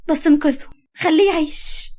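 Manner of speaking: loud, high, desperate plea; tearful begging. A narrator's reading. Woman begging doctor to save her lover, tearfully pleading. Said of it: "بكت وكملت بتوسل".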